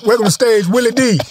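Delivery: high, pumped-up announcer voice; hyped emcee. Retold or imitated. Comic mimicking a hype man welcoming himself on stage, pumped up.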